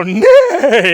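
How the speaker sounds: high loud whinny; 1 horse neigh. A narrator's reading. Narrator imitates a horse neighing. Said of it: "every now and then go".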